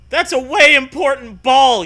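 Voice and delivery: put-on high, loud worried kid voice; acted childish plea. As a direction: beg like a panicky kid. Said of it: "you tell her".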